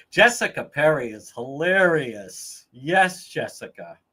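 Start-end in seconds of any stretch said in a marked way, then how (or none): none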